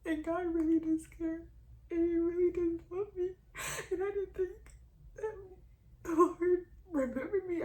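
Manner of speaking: tearful, high and loud; real crying. Real, not acted. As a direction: let the words come out through tears. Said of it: "I cry so ugly".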